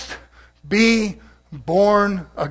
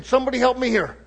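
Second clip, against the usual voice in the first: ordinary delivery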